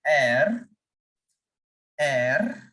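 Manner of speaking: French letter R said as "air" with a buzz; vibrating R. Shown as a demonstration. Teacher showing learners the French R, vibrating. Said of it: "make sure that you are feeling vibration".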